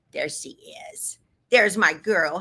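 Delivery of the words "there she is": soft breathy whisper; tender whispered hello to her dog. Real, not acted. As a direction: hush it out to the dog. Greeting her dog softly.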